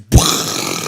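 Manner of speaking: loud mouth-made explosion noise; imitated water burst. Shows friend the valve falling off, explosive burst noise. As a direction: blow out a sudden burst of spray.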